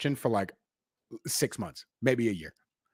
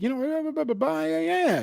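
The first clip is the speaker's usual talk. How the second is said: mocking blah blah. Real, not acted. Mocking own spiritual past.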